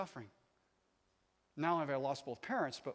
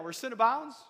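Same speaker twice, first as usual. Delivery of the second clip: louder, rising call for the crowd; excited call-out; 9 semitones higher, 11 dB louder than usual. Shown as a demonstration. Preacher rousing the congregation, louder and excited. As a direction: pump the congregation up. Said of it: "Get excited now".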